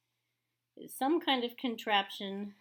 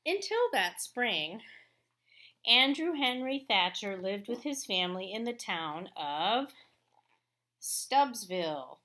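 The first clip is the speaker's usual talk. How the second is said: louder, measured read-aloud voice; story narration; 2 semitones higher, 7 dB louder, slower than usual. A narrator's reading. Teacher reading a picture book to her class, storytelling voice.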